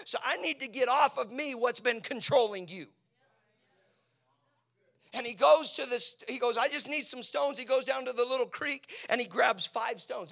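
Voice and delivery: loud, high pulpit shout; sustained high shouting, easing at the very end; full-force preaching. Real, not acted. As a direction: preach it as a battle cry.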